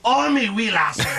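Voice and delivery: high, slow hammed-up Scottish brogue; Scottish accent. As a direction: ham up an old-fashioned Scottish accent. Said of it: "I can do the Scottish accent quite well".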